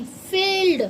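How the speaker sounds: फील्ड said with a long, drawn-out ee; long ee in field. Shown as a demonstration. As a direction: stretch the ee of फील्ड for the class. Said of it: "long sound में".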